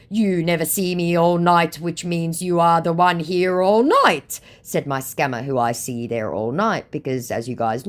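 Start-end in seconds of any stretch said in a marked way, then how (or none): 0.1-4.4 raised, louder put-on retort; mock accusation. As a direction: imitate the scammer turning the blame round. Acts out scammer's accusing reply.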